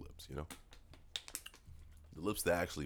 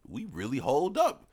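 very high, excited squeal building louder; hyped amazement; 6 dB louder than usual. Retold or imitated. Friend marvels at the family's good genes, hyped. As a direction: climb into a high, loud boast. Acted amazed boast about ageing well.

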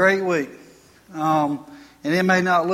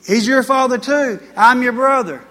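voicing Jesus; 4 semitones higher, 6 dB louder than usual. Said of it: "he's saying".

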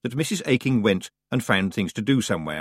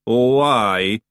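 louder Scottish growl; growling Feegle; 7 dB louder than usual. A narrator's reading. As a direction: grumble it like a suspicious brother. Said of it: "he growled".

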